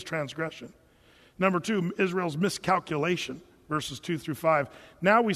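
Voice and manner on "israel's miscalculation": ordinary talk, nothing marked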